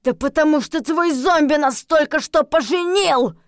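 high, loud angry outburst; exasperated full-voiced yell; shouting. A narrator's reading. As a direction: shout the explanation in fury. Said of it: "прокричал Винс".

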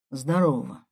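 low-key, grudging hello; grunted greeting. A narrator's reading. Brother answering his sister's call, grunted. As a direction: grunt it back without enthusiasm. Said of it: "буркнул я".

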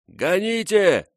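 high, slow shouted warning; shouting. A narrator's reading. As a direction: yell it over the roar of ice. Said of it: "его крик".

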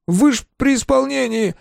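high, frightened protest; nervous, high-pitched appeal; voice jumping in alarm. A narrator's reading. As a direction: object with the pitch leaping up. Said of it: "спросил он прыгнувшим голосом".

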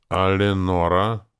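sullen. A narrator's reading. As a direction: draw out the name glumly. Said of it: "угрюмо осведомился Стади".

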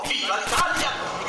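high, loud, drawn-out rallying cry; shrieked battle cry. A narrator's reading. Righetto leading the charge on the soldiers, high rallying cry. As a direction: shout it while leaping to the charge. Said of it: "strillò".

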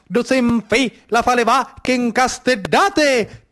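rising theatrical narration, pushing through the rhythm; dramatic storytelling. Acted. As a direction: tell the slaughter like a stage epic. Epic retelling of the tuna slaughter. (racconto teatrale incalzante)

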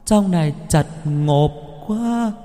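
high, slow, drawn-out ghost moan; ghostly wail. Acted. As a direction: wail like a ghost trapped in the coffin. Dead grandma calling from coffin. (giọng ma than vãn, cao và chậm)

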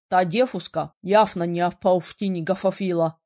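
talking through a mouthful of pie; words mumbled round a bite; mouth full. Acted. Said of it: "с набитым ртом проговорил он".

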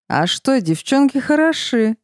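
slurring, tongue tied with drink; slurred drunken speech. A narrator's reading. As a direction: drunk man, words thick and slow. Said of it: "говорил заплетающимся языком".

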